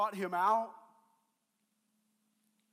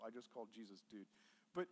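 normal talking voice

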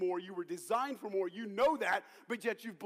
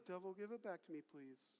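very soft, timid little request; meek pleading; 15 dB quieter than usual. Shown as a demonstration. Preacher showing congregation how not to say it, meek pleading. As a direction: ask feebly, as if not meaning it. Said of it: "None of this".

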